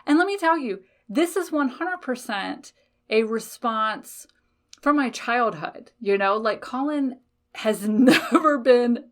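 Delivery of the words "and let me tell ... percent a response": usual speaking voice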